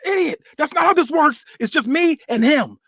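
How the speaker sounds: high, loud scornful snap; angry retort. Acted. [name] telling Javon it's just him and Khan, high angry retort. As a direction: cut him down, pitch way up. [name] snapping back on mic.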